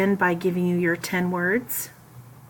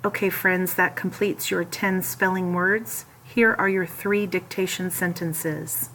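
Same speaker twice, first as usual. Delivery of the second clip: ordinary talk, nothing marked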